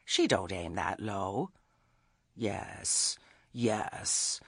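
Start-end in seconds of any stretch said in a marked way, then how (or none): 2.3-4.4 quiet weary moan. A narrator's reading. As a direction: sigh the words out, worn down. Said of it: "poor chick almost moaned".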